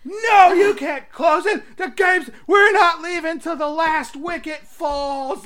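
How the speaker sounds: mock-outraged fans yelling; indignant shout. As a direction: rail against closing the pub. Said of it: "most indignant cricket fans".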